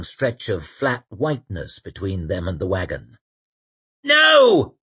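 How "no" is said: high, loud shout. A narrator's reading. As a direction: yell it back. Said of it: "he shouted".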